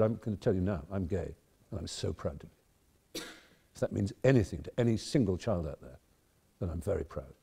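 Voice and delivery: tearing up. Real, not acted. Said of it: "I'm all tearing up now".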